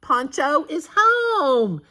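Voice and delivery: high, loud and slow call; girl calling out that the dog is home. A narrator's reading. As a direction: call the news out joyfully. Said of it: "Marisol called out".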